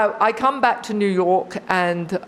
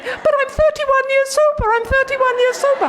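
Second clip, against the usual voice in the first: much higher, louder frantic protest; pitched-up panicked protest, faster on the repeat; desperate pleading; 3 dB louder than usual. Pretend. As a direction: plead in alarm as they drag you off. Imagined pleading while dragged out.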